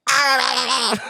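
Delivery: high shrill mock shriek. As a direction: squeal like the woman in the story. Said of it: "she's like".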